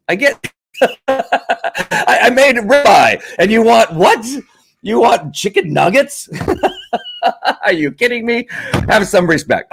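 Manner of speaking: hammed-up outrage at the kid's request; mock disbelief. Parent mocks child's dinner demand.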